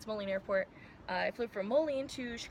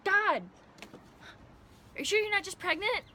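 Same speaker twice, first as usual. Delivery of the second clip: high loud mocking mom voice; mother asking daughter if she's pregnant, high and loud; mom exasperated; 10 semitones higher, 6 dB louder than usual. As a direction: play the suspicious mom, shrill with disbelief.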